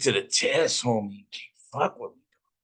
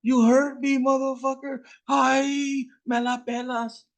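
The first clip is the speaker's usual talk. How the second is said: put-on hurt kid shouting; slower than usual. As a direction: yell it high and hurt. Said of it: "Ben tells him".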